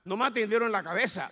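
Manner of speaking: ordinary talk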